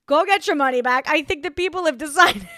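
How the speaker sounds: ordinary talk, nothing marked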